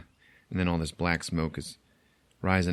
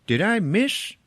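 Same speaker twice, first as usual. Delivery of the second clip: baffled question; 13 semitones higher, 3 dB louder, slower than usual. Retold or imitated. Retold confused moviegoer question.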